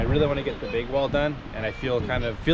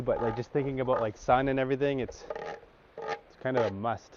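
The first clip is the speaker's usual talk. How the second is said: ordinary talk, nothing marked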